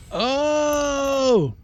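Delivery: long, high, loud "oh!" as it clicks; drawn-out, high cry of sudden understanding; dawning realization. Real, not acted. Player finally grasping a card's trick, sudden realization.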